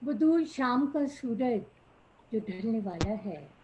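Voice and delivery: measured verse delivery, lilting down each line; poetry recitation. Acted. Poet repeating her opening line to the audience, reciting. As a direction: recite the couplet for an appreciative gathering.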